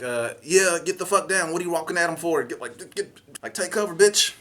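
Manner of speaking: high, loud urgent orders; urgent yell. Retold or imitated. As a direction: yell him to take cover. Yelling at him to hide.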